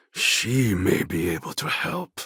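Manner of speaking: ordinary talk, nothing marked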